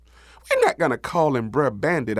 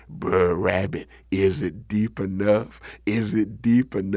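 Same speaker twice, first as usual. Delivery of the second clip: low, slow bullfrog call; deep, drawn-out frog voice; big frog hollering; 4 semitones lower than usual. Acted. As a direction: croak it up slowly from below. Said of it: "he hollered up to old rabbit".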